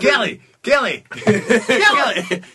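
high, loud repeated name-calling; mock calling out. Comedian pretends to hail Kelly mid-riff, calling out loudly. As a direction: holler the name for attention, playfully.

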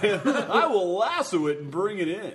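mock Jimmy Stewart. Joining the Jimmy Stewart bit.

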